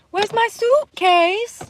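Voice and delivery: put-on high, slow Slovakian-tinged child voice; Barron Trump impression. Imitating Barron's viral clip, high slow accented child voice. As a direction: do the viral kid asking for his suitcase. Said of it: "Barron Trump".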